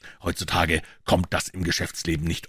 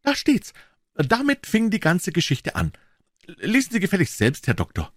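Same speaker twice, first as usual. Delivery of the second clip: higher-pitched eager pawnbroker; Wilson's voice; 13 semitones higher than usual. A narrator's reading. Wilson, the pawnbroker, answering Holmes, in a higher voice. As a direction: pitch him up as the earnest tradesman.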